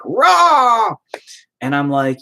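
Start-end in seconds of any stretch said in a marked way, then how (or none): 0.0-1.0 high shouted mock roar of fury; mock angry roar. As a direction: bark it like a caricature of outrage. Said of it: "and she's very, like".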